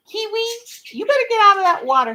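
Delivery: high, loud scolding call; calling the bird. Real, not acted. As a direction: holler at the bird to get out.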